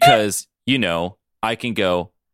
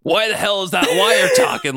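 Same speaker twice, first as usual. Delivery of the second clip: high, strained indignant shout; 12 semitones higher, 4 dB louder than usual. A narrator's reading. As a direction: shout it in disbelief. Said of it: "Logan shouted".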